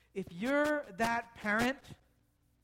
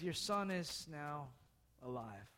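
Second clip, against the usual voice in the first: gentle announcement; 9 semitones lower, 11 dB quieter than usual. Retold or imitated. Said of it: "he carries the boy down and says".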